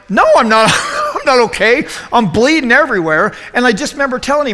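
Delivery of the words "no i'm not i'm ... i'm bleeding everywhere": panicked protest. Retold or imitated. Retold panicked self bleeding.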